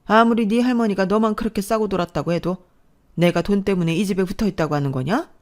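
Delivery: ordinary talk, nothing marked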